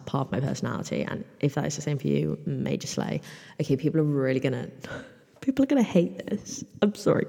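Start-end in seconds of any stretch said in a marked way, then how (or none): 5.4-7.3 giggling, pitched well up; real laugh breaking into the words. Real, not acted. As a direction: laugh through the line, half apologising. Laughing at her silly bit.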